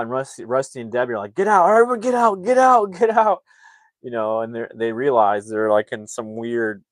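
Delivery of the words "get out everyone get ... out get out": high, loud panicked shouting; freaking out. Retold or imitated. Parents order everyone out of the sewage, freaking out. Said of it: "everyone's freaking out".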